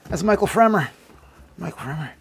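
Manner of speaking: normal talking voice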